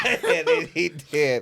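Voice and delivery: drawn out on a real laugh. Real, not acted. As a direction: crack up while repeating the punchline. Laughs repeating his punchline.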